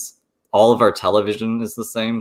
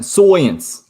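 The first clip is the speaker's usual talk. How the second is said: higher, louder Brooklyn twang; Brooklyn accent; 9 semitones higher, 6 dB louder than usual. Acted. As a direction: say it like a New Yorker. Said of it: "what's that accent?".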